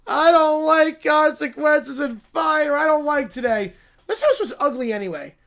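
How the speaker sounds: put-on yelled, crying kid complaint; bratty cub wailing at the ruins; whiny child tantrum. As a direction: stamp your foot like a four-year-old.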